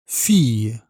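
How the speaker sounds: "fille" said fij, with an I and a Y glide; I sound demo. Shown as a demonstration. Teacher to learner, modelling the feminine: I and Y glide. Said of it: "avec le son I".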